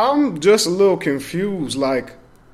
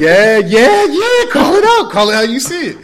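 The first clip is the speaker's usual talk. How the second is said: high, loud hype; cheering on a fight; 10 semitones higher, 8 dB louder, slower than usual. Real, not acted. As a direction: egg him on, hyped.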